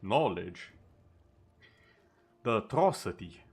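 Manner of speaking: mock horror, sarcastic. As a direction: feign disgust with heavy sarcasm. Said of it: "I'm being very sarcastic".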